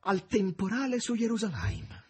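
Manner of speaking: high, loud, anguished woman's voice; Margherita pleading. A narrator's reading. Margherita reproaching the master who sends her away, high and anguished. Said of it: "sussurrava Margherita".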